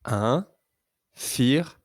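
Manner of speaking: "Infirmière" very slowly in three separated beats; syllable by syllable. Shown as a demonstration. Teacher counting syllables with a pupil, "Infirmière" syllable by syllable. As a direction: break "Infirmière" into in, fir, mière with clear gaps. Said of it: "Combien de syllabes entends-tu".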